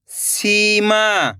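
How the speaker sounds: Seema joined as one slow word; blended word. Shown as a demonstration. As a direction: read Seema whole with drawn-out vowels, falling at the end. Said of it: "खींच के पढ़ना है".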